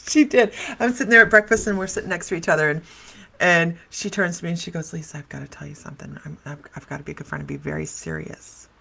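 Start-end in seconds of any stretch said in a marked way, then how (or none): 4.9-8.7 a softer, deadpan serious friend; imitating her dead-serious warning, quietly. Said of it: "She's deadpan, deadpan serious".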